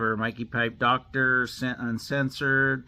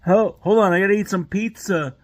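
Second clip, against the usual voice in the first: raised, loud mock plumber voice; John Phillips Plumbing impression; 6 semitones higher, 7 dB louder than usual. Plumber vlogger parody stalling for pizza, voice raised. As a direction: keep up the put-on plumber voice. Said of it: "John Phillips Plumbing".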